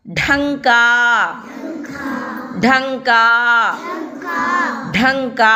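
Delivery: ఢంకా with a breathy, aspirated retroflex ఢ. Shown as a demonstration. Teacher drilling the child on the aspirated ఢ. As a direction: push air out on the ఢ of ఢంకా. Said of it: "డా నేర్చుకున్నాము. ఇది రెండో డా".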